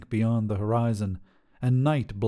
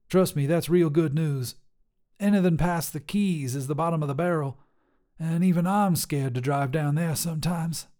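higher, bold trucker woman voice; tough lady trucker; 6 semitones higher than usual. Acted. Trucker Sharon sizing up a hitchhiker, brash and higher. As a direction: call out like a brash long-haul trucker.

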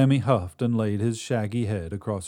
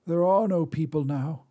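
put-on old Drea; 7 semitones higher than usual. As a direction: snap at him like a crotchety crone. Drea speaks to the god.

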